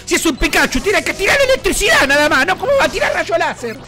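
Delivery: high-pitched incredulous protest; exasperated, voice climbing high; indignant rant. Real, not acted. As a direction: argue with the game, worked up.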